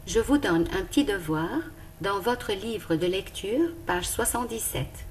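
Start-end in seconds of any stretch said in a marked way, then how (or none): none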